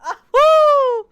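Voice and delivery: breathless gasp into a loud "woo"; high loud whoop. Real, not acted. Recovering from a laughing fit.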